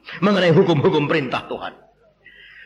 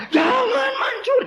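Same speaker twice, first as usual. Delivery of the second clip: shouted command; slower than usual. Acted. Preacher shouts the commandment at his congregation. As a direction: yell the commandment.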